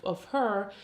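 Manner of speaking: ordinary talk, nothing marked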